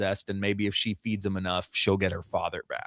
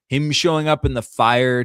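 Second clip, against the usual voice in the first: ordinary delivery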